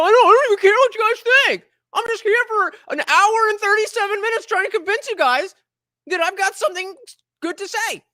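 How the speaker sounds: high-pitched mocking rant, loud at the start; mocking protest. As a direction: mimic someone insisting far too hard. Mocking quote of someone pretending not to care.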